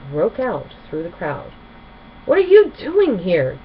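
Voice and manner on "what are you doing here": high, loud angry screech. A narrator's reading. Gilda confronting the ponies, screeching angrily. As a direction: screech at the intruders. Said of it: "Gilda spread her wings and screeched".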